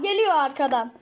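usual speaking voice

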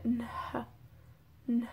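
Nh as a held n released into h, softly; nasal mutation sound. Shown as a demonstration. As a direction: isolate Nh for learners, n then a puff of h. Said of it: "que cambia a N H".

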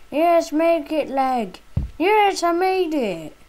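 high, loud triumphant yell; excited cheer. Real, not acted. Player cheers his own landing, high and loud.

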